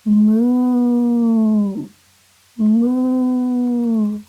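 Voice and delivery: loud, long mooing cow. Shown as a demonstration. Teacher mooing for kindergartners, loud and long. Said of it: "It's a cow sound".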